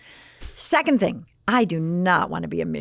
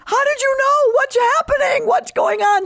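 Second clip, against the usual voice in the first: high, loud teary questions; crying; 6 dB louder than usual. Retold or imitated. Student caught by roommate, crying out. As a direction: blurt it out through tears. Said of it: "I started crying".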